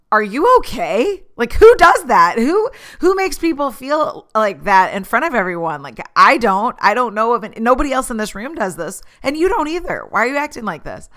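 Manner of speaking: high, loud incredulous rant; imagined outburst. Retold or imitated. To the colleague on pain medicine, a high exasperated rant. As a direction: let it all out at him. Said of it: "I wanted so bad to be like".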